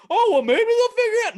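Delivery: very high, loud mock optimism; silly squeaky wishful thinking; naive hope. Fan convincing himself to buy in, mock hopeful.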